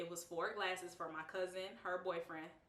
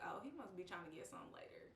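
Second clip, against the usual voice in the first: quiet fast aside; 13 dB quieter, much faster than usual. Retold or imitated. Inner thought retold.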